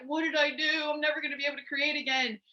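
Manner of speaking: high, fast, anguished wail; replaying her panic in her room; mock despair.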